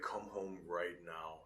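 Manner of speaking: slow, quiet, stern command; a dad holding his anger down, slowly; stern dad order. Retold or imitated. Angry dad ordering his son home, slow and quiet. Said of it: "He's like".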